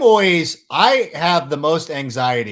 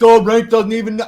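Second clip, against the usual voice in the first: higher, louder mock fan whine; 10 semitones higher, 9 dB louder than usual. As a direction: sneer like an offended fan. Mocking upset Saints fans.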